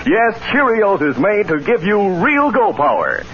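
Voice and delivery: usual speaking voice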